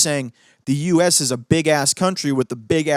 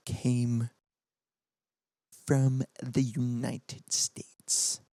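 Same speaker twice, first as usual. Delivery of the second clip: softer and slow, each word weighed; slow emphatic point; 3 semitones lower, 8 dB quieter, much slower than usual. Real, not acted. Telling viewers where the revenue came from, slow and softer. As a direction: let the fact sink in slowly. Stressing a box office figure.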